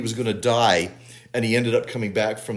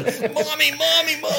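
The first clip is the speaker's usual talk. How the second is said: put-on very high little-girl squeal; mock screaming. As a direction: imitate a frightened child calling for mother. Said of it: "starts screaming".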